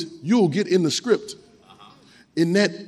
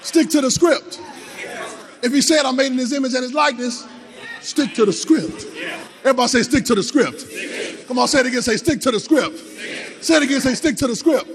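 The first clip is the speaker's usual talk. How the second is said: preacher leading the church to repeat; higher, emphatic call to the congregation; call and response; 5 semitones higher than usual. Acted. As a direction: drive the crowd to echo back.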